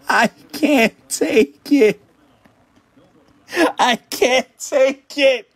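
high, slow, stunned wail; overwhelmed disbelief. Real, not acted. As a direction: drag it out in disbelief. Ecstatic over big card pull.

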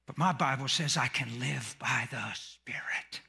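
low, soft whispered line. Acted. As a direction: drop to a hushed secret. Sudden hush in sermon.

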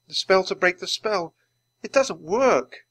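high-voiced dismay, slowing at the end. Teddy finds the spell has failed, dismayed. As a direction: let the defeat drag out the last words.

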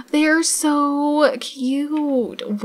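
very slow, high gushing coo; drawn-out coo. Real, not acted. Admiring the Sims couple on the ride, slow coo. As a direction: stretch each word out in adoration. Cooing over couple at fair.

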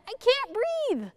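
high, squeezed and panicked; breathless panic. Acted. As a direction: squeeze it out, no air. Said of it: "gasped Head".

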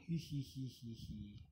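tittering giggle that falls away; little hee-hee giggle. Pretend. Player joking about terrible gameplay, hee-hee giggle.

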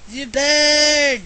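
loud, high yelling "You burn!"; shouted taunt. Acted. Gamer yelling "You burn!" at Voldemort, loud and high. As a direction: roar it at the boss like a spell. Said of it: "I say".

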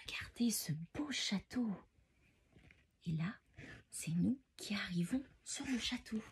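hushed storytelling whisper. Acted. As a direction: whisper like sneaking through a castle. Reading bedtime book in whispers.

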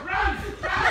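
shouting. Real, not acted. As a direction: shout it out in alarm. Calling out to flee.

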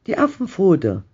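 high-pitched excited exclamation; wild cry. A narrator's reading. Wife cries out to sleeping husband, high and wild. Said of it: "schrie sie".

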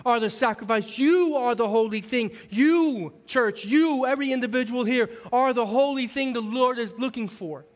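high, forceful sermon delivery; preaching. Acted.

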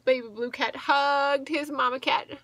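ordinary delivery

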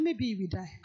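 a hint of dread, voice dropped low; low worried quote. Fearful man sighs to himself, low and worried. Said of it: "he said".

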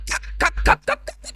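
exaggerated fake cackle; imitated cackling laugh. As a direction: mimic a man laughing in short bursts. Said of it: "ನಗಲಕತ್ತಾನ".

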